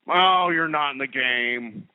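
mock bigot voice. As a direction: play the clueless bigot, drawn out.

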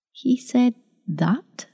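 slow, husky, hushed question. A narrator's reading. Young woman asks grandmother, stunned, in a slow husky voice. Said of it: "she asked huskily".

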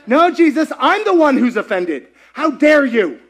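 high, loud, indignant mock outrage; playfully outraged Jesus voice; mock offended. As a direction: throw the offense right back, hammed up.

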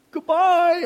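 loud, high-pitched goodbye; mock farewell. Acts out a hypothetical quick farewell.

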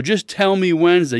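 retold exasperated pleading for an answer. Retelling his exasperated phone argument.

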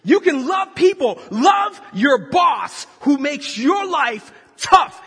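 loud, high, slow shouted plea; preaching shout. Acted. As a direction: hammer it home to the crowd. Preacher shouting about the boss.

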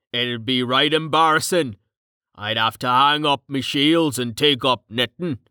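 colloquial, teasing big man; rough-and-ready joker. Acted. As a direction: break up the sentiment with blokey banter. Voices Carten joking.

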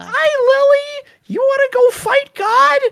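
very high, loud squeaky voice; high cartoon voice. Cartoon voice to the dog Lily.

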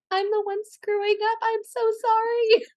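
high, sheepish apologising, imitating herself; mock apology. Actress apologising on set, high and sheepish. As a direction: cringe through the apology. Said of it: "you're like".

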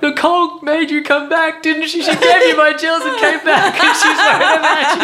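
high, loud babbling insistence, laughter creeping in; acted delirium. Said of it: "yeah, delirious".

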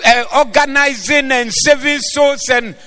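high, loud preaching over music; full-force preaching. Acted.

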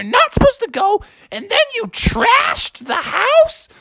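very high, scolding mother; outraged mom. Acted. Mom finding the house trashed, outraged. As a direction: scold in outrage, stretching the key words. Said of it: "She said".

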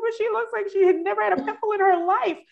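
retold amazement at flawless skin; astonished disbelief. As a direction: act out your jaw-dropping surprise. Retold disbelief at patient's clear skin.

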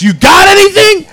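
high-pitched imitated yell; shouted demand. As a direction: holler it like a pushy street stranger. Voicing the stranger demanding drugs.

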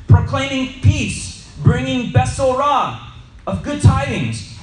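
high, loud and slow proclamation; proclaiming. Acted. Preacher reading Isaiah to the class proclaims loudly. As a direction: ring it out like a herald.

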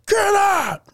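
high-pitched mock shout; drunk teacher yelling. Drunk English teacher throwing a student out, mock yelling. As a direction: imitate a drunk teacher's sudden yell. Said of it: "He just started yelling".

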